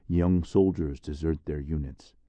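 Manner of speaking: ordinary delivery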